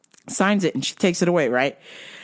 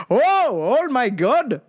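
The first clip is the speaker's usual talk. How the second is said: high, swooping dad voice; overdone dad gag ending in fake laughing; joking dad; 7 semitones higher than usual. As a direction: imitate dad hamming up his astonishment. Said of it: "he's just like".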